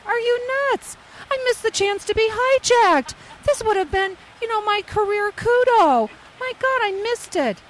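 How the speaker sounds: high, loud, disbelieving; indignant disbelief. Retold or imitated. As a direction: play it as outraged at missing the hijacking. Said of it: "I'm looking at him like".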